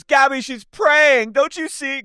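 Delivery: high, slow, loud mock mom; imitating mom. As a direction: scold Gabby like a zealous mother. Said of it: "And Andrea goes".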